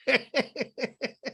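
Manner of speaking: high, stuttering laugh; helpless high giggle; real laugh. Real, not acted. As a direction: crack up in a string of high ha-has. Host laughs at retold joke.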